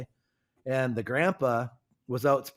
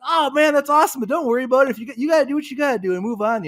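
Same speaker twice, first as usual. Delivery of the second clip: much higher, louder excited thanks; delighted neighbor; 11 semitones higher, 9 dB louder, much faster than usual. Retold or imitated. New neighbor thanks the farmer, much higher and louder with delight. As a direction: gush with grateful surprise.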